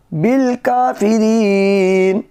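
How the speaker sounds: melodic tajweed chant; Quran recitation. Acted. Recites verse.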